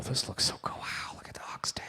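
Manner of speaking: hushed, wondering whisper; awed whisper. Acted. Canadians ordering with no clue, awed whisper. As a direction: whisper it like a marvelling onlooker. Awed diners reading the menu.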